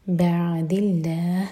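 slow, lingering on the ع of بعد; held ain. Shown as a demonstration. Teacher modelling the held ain for students, slow. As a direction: give the ع of بعد a little time. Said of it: "عین پر تھوڑا time دیں".

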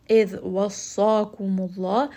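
Quran recitation. Acted. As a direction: recite the ayah in tajwid style, full voice.

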